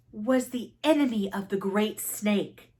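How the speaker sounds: ordinary delivery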